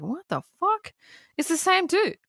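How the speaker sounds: high-pitched mock astonishment; retold disbelief. Friend finding the missing persons listing, mock astonished. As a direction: act out a stunned discovery.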